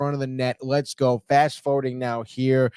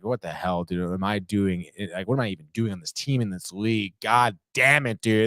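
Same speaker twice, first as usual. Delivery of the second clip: exhausted player; 8 semitones lower, 3 dB quieter than usual. Acted. Acting exhausted player questioning himself.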